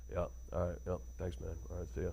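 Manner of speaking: low, very soft dismissive mumble. Retold or imitated. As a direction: brush them off and hang up. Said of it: "it goes back to".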